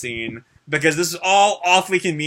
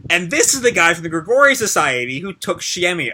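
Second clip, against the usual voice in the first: usual speaking voice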